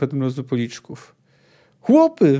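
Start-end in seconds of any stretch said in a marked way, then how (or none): 1.8-2.4 high, loud rallying shout; urgent high-pitched call; yell. A narrator's reading. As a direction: yell to your men. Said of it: "wrzasnął".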